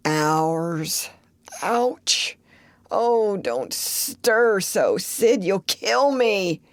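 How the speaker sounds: slow, hammed-up suffering voice; milk the pain for sympathy; mock pain. Tom faking pain to frighten Sid, slow moaning.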